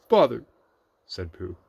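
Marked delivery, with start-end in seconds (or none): 0.1-0.5 high, loud little exclamation. A narrator's reading. Pooh thwarted at the hole, vexed character voice. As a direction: exclaim it as a storybook bear.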